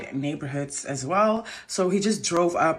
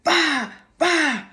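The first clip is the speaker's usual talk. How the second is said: threatening date; 6 semitones higher than usual. Retold or imitated. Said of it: "He was like".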